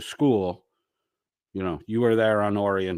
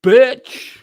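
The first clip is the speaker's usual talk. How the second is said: high, loud, rising taunt; squeaky mock insult; 11 dB louder than usual. Taunting Phil in a high, loud, rising squeal. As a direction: squeal the word like a bratty kid.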